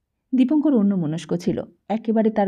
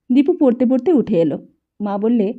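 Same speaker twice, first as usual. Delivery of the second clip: usual speaking voice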